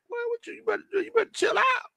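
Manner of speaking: very high, squealing warning through laughter; tickled telling-off, building to loud; laughing mock scolding. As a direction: playfully warn a friend while cracking up. Laughing while scolding Dre in jest.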